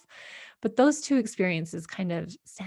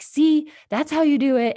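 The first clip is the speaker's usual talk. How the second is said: higher, louder exasperated mother; retold mom, louder and higher; annoyed mom; 8 semitones higher, 7 dB louder than usual. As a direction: snap it out like a fed-up mom.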